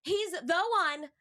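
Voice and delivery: slow, high hype-man announcement; hype intro. Acted. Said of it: "You did an amazing job channeling the hype of it all".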